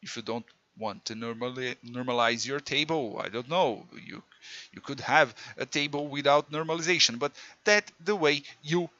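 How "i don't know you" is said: usual speaking voice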